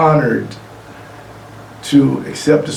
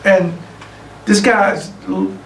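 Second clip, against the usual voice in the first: ordinary talk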